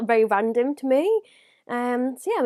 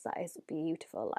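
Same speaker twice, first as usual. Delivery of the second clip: hushed awe; 5 semitones lower, 13 dB quieter than usual. Real, not acted. Admiring palette softly.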